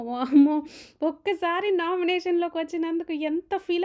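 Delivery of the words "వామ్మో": వామ్మో burst out louder; second వామ్మో thrown out loud in disbelief; loud wow. Real, not acted. Repeats exclamation, much louder.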